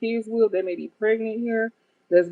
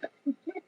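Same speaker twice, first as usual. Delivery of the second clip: real laugh squeaking up high and soft; an airy giggle breaking out high; helpless high giggle; high burst of laughter; 9 semitones higher, 10 dB quieter than usual. Real, not acted. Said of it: "I'm trying not to laugh".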